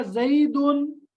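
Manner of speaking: زیدن read slowly and clearly; slow demo reading. Shown as a demonstration. Teacher slowly reading out the example زیدن. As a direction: sound out زیدن slowly for the class. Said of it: "ایک مثال ہے".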